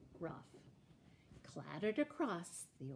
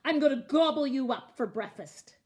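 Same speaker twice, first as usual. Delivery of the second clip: loud, fast, hungry troll; roaring troll threat; 4 semitones higher, 12 dB louder, faster than usual. A narrator's reading. Troll threatening to eat the middle goat, roaring. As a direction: threaten loudly at a rush. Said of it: "roared the troll".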